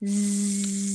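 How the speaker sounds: buzzing like a bee; a long, steady bee buzz. Pretend. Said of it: "Mine's a bee".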